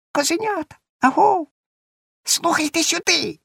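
very high, thin calling voice; tiny chirping squeak. A narrator's reading. Hidden cricket hails the goat kids, thin squeaky call. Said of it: "тоненько-тоненько пищить, цвірчить".

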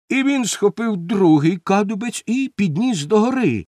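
ordinary delivery, nothing marked

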